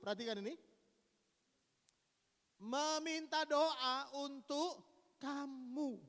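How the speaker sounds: loud, proclaiming voice of God; forceful scripture reading. Acted. Preacher voicing God rebuking Eliphaz. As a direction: declare it with authority.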